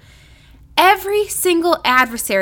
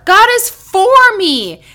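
for punched higher and louder; stress on FOR; 7 semitones higher, 7 dB louder, slower than usual. Shown as a demonstration. Preacher showing emphasis on for. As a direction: hit the word for. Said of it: "The next one is the word for".

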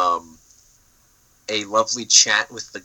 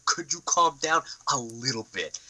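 softer, in a changed, patronising voice; put-on condescending plea; 3 semitones higher, 6 dB quieter than usual. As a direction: plead mockingly in a silly voice. Repeats with changed voice.

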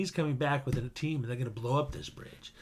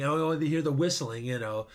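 ordinary talk

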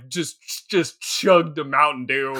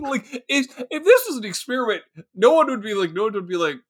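ordinary delivery

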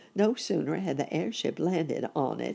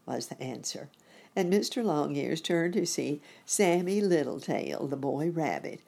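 plain reading voice

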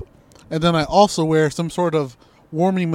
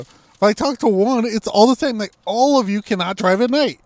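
higher and louder, worked-up rant; exasperated rant; 7 semitones higher, 6 dB louder than usual. Real, not acted. Ranting to his co-host about his dates, loud and fed up. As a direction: vent loudly, fed up.

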